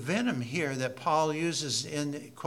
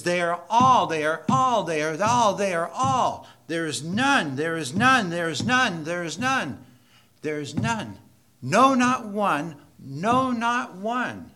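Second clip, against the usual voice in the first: loud, higher, hammering every all and none; preaching at full force; 4 semitones higher, 8 dB louder than usual. Acted. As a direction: thunder the verdict over the congregation. Preacher's repeated climax.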